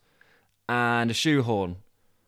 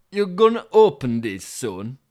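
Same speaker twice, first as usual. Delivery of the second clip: loud Yorkshire delivery driver; 2 semitones higher, 6 dB louder than usual. Acted. Driver asking about unopened boxes, Yorkshire accent. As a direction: ask loudly in a Yorkshire accent. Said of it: "it'll be another Yorkshire".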